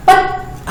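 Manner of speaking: पत- breathed, cut short; just breathe पत- and stop; whispered half syllable. Shown as a demonstration. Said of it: "उच्चारण में भी हम". (पत- फुसफुसाकर)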